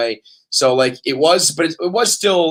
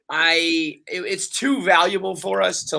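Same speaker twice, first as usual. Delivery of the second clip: ordinary talk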